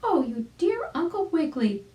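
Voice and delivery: exclaiming chick. Acted. Arabella greeting Uncle Wiggily, high delighted chick voice. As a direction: squeal with joy at seeing him. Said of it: "Arabella exclaimed".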